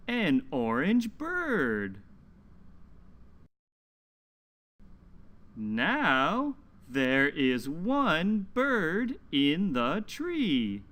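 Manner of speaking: slow, lilting picture-book reading; reading to children. Acted. Teacher reading a story aloud to children, slow and lilting. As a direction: read it slowly to little listeners, leaning on the numbers.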